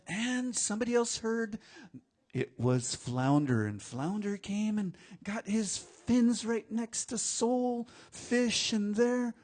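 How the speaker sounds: high, sustained storyteller chant; heightened, suspenseful tale telling; oral storytelling. A narrator's reading. Storyteller continues the rescue.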